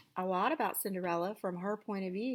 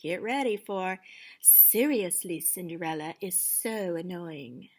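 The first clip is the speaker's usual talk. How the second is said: ordinary delivery, nothing marked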